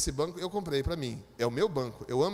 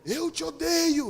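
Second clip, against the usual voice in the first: high, slow, loud demonic voice; hammed-up possessed man snarling; 9 semitones higher, 5 dB louder, much slower than usual. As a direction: stretch it out like a demon. Possessed man's hateful voice.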